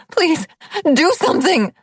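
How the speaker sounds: high, loud urgent begging; desperate plea. A narrator's reading. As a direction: beg for help in panic. Urging the guard.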